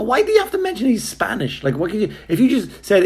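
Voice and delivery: high, incredulous outrage; indignant. Retold or imitated. Guest protesting the clerk's warning, high and indignant. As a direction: squeak out the objection in disbelief. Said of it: "And I go".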